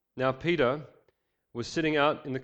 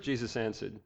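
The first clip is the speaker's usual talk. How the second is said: normal talking voice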